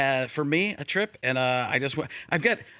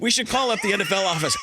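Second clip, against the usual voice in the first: normal talking voice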